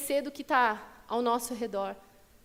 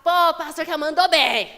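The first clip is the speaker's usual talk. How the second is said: loud, laughing bro-style "Pô, pastor que mandou bem"; loud laughing slangy voice; slangy casual praise; 3 semitones higher, 9 dB louder than usual. Retold or imitated. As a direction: ham up a careless churchgoer's compliment. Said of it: "Em vez de falar".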